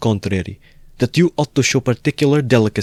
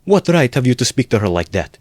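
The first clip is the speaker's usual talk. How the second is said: higher-pitched indignant challenge; hot, protective protest; heated intervention; 5 semitones higher than usual. A narrator's reading. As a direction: break in hotly on her behalf. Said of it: "Pulcheria Alexandrovna intervened hotly".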